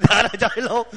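words breaking up in real chuckles; laughing. Real, not acted. Teacher amused by the joke ending to the verse, laughing as speaking. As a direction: repeat the punchline through laughter.

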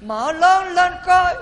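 high, loud, drawn-out heckle; hammed-up high shout ending in laughter; mock yelling. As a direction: yell it like an audience member from the back. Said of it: "cái ở dưới la làng lên nói".

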